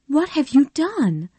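anxiously. Acted. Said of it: "asked her husband anxiously".

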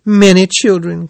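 high, loud, plaintive cry; milk the sympathy. A narrator's reading. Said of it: "cried Toad".

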